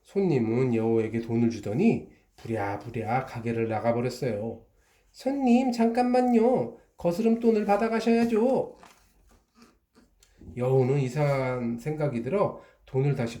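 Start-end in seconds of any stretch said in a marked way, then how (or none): 5.1-8.8 much higher, louder call across the shop; calling after. Acted. Fox calling the customer back for change, high call. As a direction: call out to stop them leaving.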